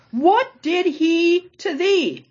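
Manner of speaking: high, slow incredulous What did he to thee?; exasperated. Shown as a demonstration. Teacher voicing the Pharisees, exasperated. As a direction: stretch it out in disbelief. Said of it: "exasperated mock version".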